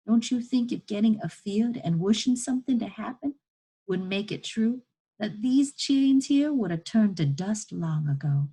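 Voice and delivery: challenging enslaved woman. Acted. Chained woman challenging boy tugging at chains, indignant. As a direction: reproach the child, building in force and pitch.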